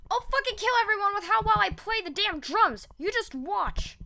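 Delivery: high strained furious threat; high furious vow; raging threat. Acted. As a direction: rant it with manic fury. Bakugo threatens in rage.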